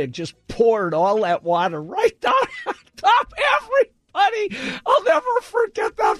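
words squeezed out through laughter; high-pitched laugh breaking the words. Real, not acted. Laughing at a funny memory.

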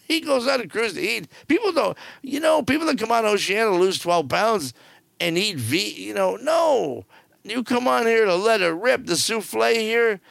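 lifted, gleeful food enthusiasm. Real, not acted. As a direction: raise it up, delighted about eating. Excited about eating on cruises.

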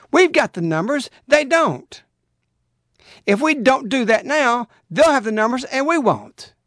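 high, insistent call to arms; rallying preaching. Acted. Preacher rallying believers about numbers, insistent and high. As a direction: stir them up, we can win.

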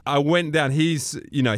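usual speaking voice

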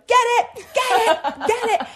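high, loud, frantic yells; urgent pleading yell at a friend. Retold or imitated. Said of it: "I started yelling".

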